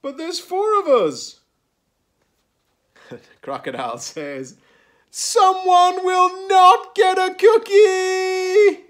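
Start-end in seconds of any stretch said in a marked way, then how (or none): 0.0-1.4 high, loud protest; alarmed. A narrator's reading. Blue Squirrel sees cookies are short, alarmed. 5.1-8.8 very high, slow, loud wail; panicked wail. A narrator's reading. Crocodile realises someone goes without cookie, panicked wail.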